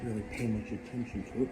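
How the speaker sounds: ordinary talk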